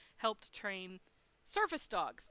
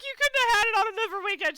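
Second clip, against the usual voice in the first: much higher, much louder cry of disbelief; exasperated outburst; 10 semitones higher, 13 dB louder, faster than usual. Retold or imitated. To mom having a heart attack, exasperated shout. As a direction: let it burst out at the bad timing. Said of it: "I'm like".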